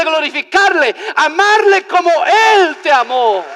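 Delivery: loud, high sermon climax; full-force preaching. Real, not acted. Preacher urging brethren to praise their rescuer, full-force preaching. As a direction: cry it out to the congregation with mounting fervor.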